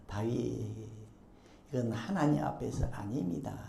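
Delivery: low, soft acted plea; gentle restraint. Nabal's wife stops David, low and soft restraint. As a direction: quietly hold back an angry man.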